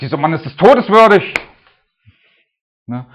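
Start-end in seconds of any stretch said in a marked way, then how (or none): 0.0-1.3 loud, high furious verdict; angry outburst. Retold or imitated. David condemns the rich man, shouting in fury. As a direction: thunder it out in burning rage. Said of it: "David entbrannte in seinem Zorn".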